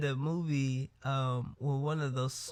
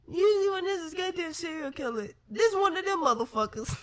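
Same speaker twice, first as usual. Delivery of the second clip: high, teary laugh through the words; crying with laughter; 13 semitones higher, faster than usual. Real, not acted. Telling the story, breaking up in high teary laughter. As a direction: choke out the words between laughs.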